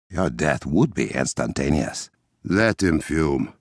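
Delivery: ordinary talk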